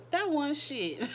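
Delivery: quoting Jocelyn through a laugh; half-laughed attempt at her line; laughing quote. Retelling Jocelyn's line to viewers, laughing. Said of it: "I can't do the Jocelyn accent".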